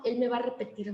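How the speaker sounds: normal talking voice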